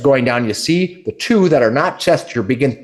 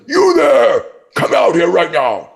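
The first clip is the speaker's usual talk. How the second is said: much higher, loud commanding ogre call; 7 semitones higher, 5 dB louder, slower than usual. Acted. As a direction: bark at the sneaking elf. Brock calls out.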